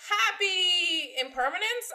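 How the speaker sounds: high, loud celebratory shout; new year cheer. Acted. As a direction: cheer it like midnight hits. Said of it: "countdown ending cheer".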